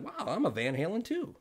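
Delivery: high, bright newcomer's voice; Sammy voice. Retold or imitated. Newcomer Sammy asks the couple where they're from, voiced high. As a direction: pitch it up as the eager newcomer. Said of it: "The newcomer said".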